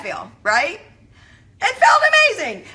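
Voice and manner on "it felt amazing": much higher, louder and fast burst of joy; excited. Real, not acted. Telling her viewers how good it felt, thrilled and loud. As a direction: gush it out, thrilled. Reliving the joyful moment.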